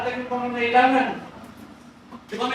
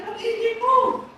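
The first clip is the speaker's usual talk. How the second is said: mother's high slow answer; 10 semitones higher, slower than usual. Acted.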